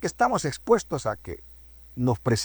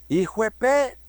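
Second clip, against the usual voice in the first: mock street shout, a bit higher; grito en la calle; 6 semitones higher than usual. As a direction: call out the insult across the street. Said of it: "oye que gritan". (grito burlón en la calle)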